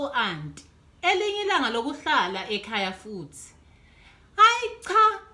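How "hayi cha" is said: put-on higher, louder child's no; child refusing. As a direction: insist like a stubborn girl. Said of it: "kusho uDudu".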